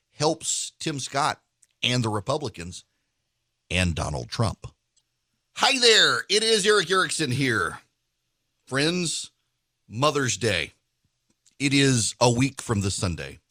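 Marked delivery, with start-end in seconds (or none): 5.5-13.4 upbeat host ad read, then easygoing recipe chat; host-read radio sponsor spot. Acted. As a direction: sell the steaks like a friendly radio host.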